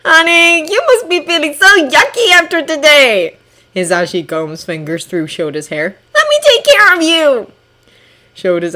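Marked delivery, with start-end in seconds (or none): 0.0-3.3 tender husband voice. A narrator's reading. Hisashi comforts husband. 6.2-7.5 tender husband voice. A narrator's reading. Hisashi comforts husband.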